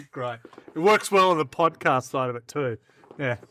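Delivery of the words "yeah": ordinary talk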